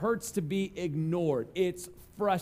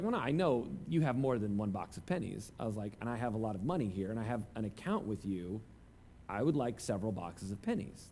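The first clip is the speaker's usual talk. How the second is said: quiet insistence; 9 semitones lower, 8 dB quieter than usual. Retold or imitated. Retells own polite bank demand.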